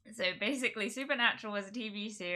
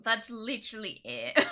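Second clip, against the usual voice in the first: usual speaking voice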